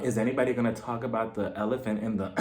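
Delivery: low, hushed aside cut short; under her breath. Retold or imitated. Tracy whispers to Melissa at the table, under her breath. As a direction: imitate a sneaky whisper to a neighbour. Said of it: "whispering underneath her breath".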